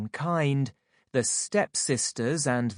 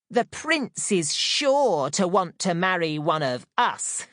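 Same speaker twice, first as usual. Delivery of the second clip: high, louder gloating; cackling stepsisters; 5 semitones higher, 3 dB louder than usual. A narrator's reading. Stepsisters gloat about marrying the prince. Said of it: "they cackled".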